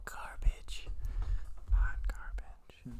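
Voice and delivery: whispering Garbage slowly; whispered. Acted. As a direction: breathe the words out with scorn. Dismissing Fortnite.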